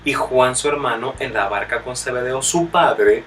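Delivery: loud, fervent sermon delivery; preaching at full force. Acted. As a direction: call out the verses to the congregation. Preaches the next verses loudly. (predicando con fuerza y fervor)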